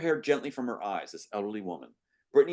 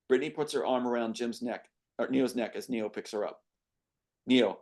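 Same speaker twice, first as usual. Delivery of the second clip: plain reading voice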